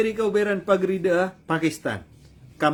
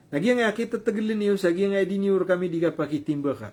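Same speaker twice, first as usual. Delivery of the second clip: ordinary delivery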